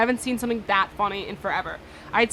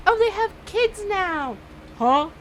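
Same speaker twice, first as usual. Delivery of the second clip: slow, high, put-on voice; a fake wide-eyed discovery; mock surprise; 8 semitones higher, slower than usual. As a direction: pretend shock at the sudden kids. Mocking the show's time skip.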